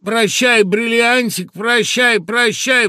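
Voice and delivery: loud, high, drawn-out cry, as if delighted; drawn-out farewell. A narrator's reading. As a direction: call it out high and stretch each goodbye. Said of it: "протянул Латкин несколько раз сряду". (протяжно, громко, радостно)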